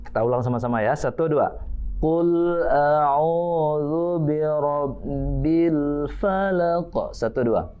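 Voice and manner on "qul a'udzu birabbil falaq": slow drawn-out Quran chant, higher; Quran recitation. Shown as a demonstration. Teacher chanting a verse for children to repeat, slow and drawn out. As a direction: chant each word slowly for the class to copy.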